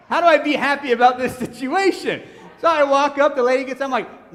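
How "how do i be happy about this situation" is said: raised, loud comic protest; mock exasperation. Preacher retelling his reaction to his hit car, mock exasperated. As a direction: plead it loudly, half laughing at yourself.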